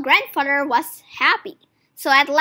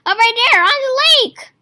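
higher, louder call across the lake; excited shout; 9 semitones higher, 6 dB louder than usual. A narrator's reading. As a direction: shout and point it out. Pointing out monster, shouting.

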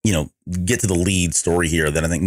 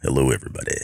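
low, smooth mock radio voice; sexy podcaster voice; 7 semitones lower, faster than usual. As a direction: drop it into a deep sultry greeting. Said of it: "that sexy podcaster voice".

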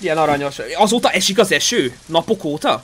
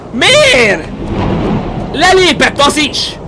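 loud, high wail of disbelief; distraught cry; 7 semitones higher, 3 dB louder, much slower than usual. Real, not acted. Streamer learning another one left, loud distraught outburst. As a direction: cry out why, stretching the words.

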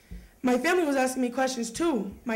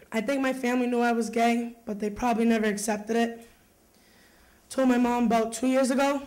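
ordinary talk, nothing marked

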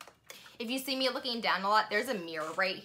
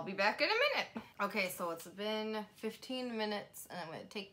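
lips restricted by sheet mask; careful limited mouth movement; through a face mask. Real, not acted. Seen 5: Wet sheet mask covers face and around mouth while talking; peeled off at end.

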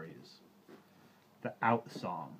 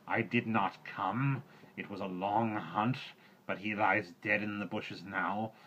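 panther Bagheera; 2 semitones higher, 6 dB louder than usual. A narrator's reading. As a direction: speak like a panther fresh from the kill. Bagheera speaks.

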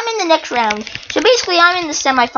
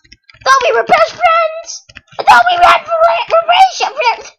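mock betrayed whine; 13 semitones higher, 4 dB louder than usual. Gamer to the opponent who hit him, mock heartbroken wail. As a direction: wail like a jilted best friend.

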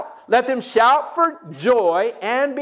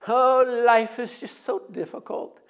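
mock Eeyore sigh. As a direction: sigh like a sad donkey. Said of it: "you talk like him".

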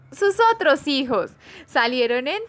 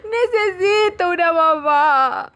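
tearful, louder cry for mama; 4 dB louder, slower than usual. Acted. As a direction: sob it out like a lost little bird. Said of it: "empezó a llorar".

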